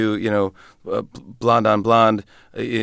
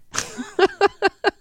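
high laugh. Real, not acted. Laughs after impression.

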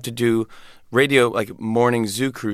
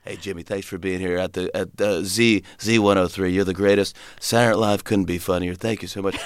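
off-air DJ; 4 semitones lower than usual. Retold or imitated. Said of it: "nicest to you off the air".

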